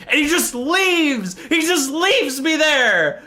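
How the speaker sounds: high, drawn-out exasperation; incredulous outrage. Retold or imitated. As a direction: stretch it out high in disbelief. Outraged at being abandoned.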